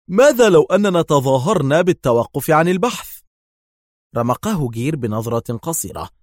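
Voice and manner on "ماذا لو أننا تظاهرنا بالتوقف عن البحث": eager suggestion, higher and louder; keenly interested. A narrator's reading. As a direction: float the idea keenly, voice raised. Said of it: "ثم قال في اهتمام".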